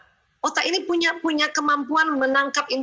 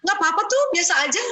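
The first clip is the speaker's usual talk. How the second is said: put-on carefree child; 7 semitones higher, faster than usual. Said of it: "Ada anak".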